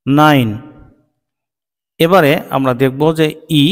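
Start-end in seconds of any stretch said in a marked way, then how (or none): none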